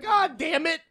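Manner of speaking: high, tearful curse, mocking himself; mock crying curse. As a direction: pretend to cry out the words. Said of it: "I start crying".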